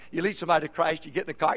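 normal talking voice

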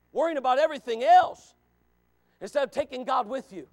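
loud, rising preacher's call; fiery pulpit preaching; full-force preaching. Acted. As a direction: preach it out to the whole room.

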